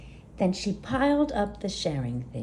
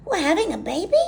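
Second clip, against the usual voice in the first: high, loud gasping question; a high loud excited gasp; 9 semitones higher, 5 dB louder, much faster than usual. Acted. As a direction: gasp it out in delight. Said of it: "gasped Gertie".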